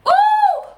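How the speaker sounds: loud, very high "ooh"; high warning whoop. Acted. Warning what happens when she feels threatened, high whoop. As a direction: let out a shrill-pitched warning hoot.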